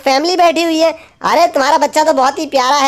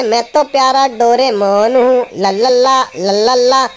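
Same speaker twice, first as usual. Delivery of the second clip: lower, slow cartoon robot-cat voice, sing-song; Doraemon voice; 4 semitones lower, slower than usual. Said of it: "चलो Doraemon से गाड़ी चलवाते हैं". (Doraemon की धीमी, नीची आवाज़)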